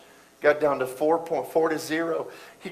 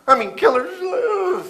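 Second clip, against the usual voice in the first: higher, slow, stressed killing her; emphatic; 6 dB louder, slower than usual. Real, not acted. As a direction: lean hard into killing her. Stresses mother's pain.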